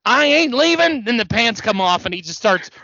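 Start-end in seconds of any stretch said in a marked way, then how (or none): 0.0-1.0 high, louder mock defiance; hammed-up defiant promo shout; imitated Ric Flair rant. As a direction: imitate a wrestler refusing to budge. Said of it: "and then he goes".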